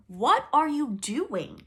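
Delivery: slow, loud, high angry dragon; dragon's scream. Acted. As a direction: roar it at her. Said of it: "the dragon screamed at her".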